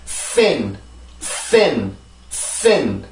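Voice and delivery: "sin" with a long hissed s before the falling vowel; strong airy S. Shown as a demonstration. Teacher demonstrating the S in "sin" for learners, strong airy S. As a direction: push plenty of air through the s of "sin", then land on the vowel. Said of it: "앞에 강한 공기가 굉장히 많이 나와요".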